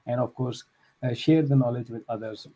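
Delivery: ordinary delivery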